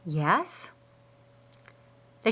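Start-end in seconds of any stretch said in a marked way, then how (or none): 0.0-0.7 nervous. A narrator's reading. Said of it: "swallowed hard and said".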